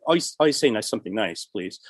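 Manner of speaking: normal talking voice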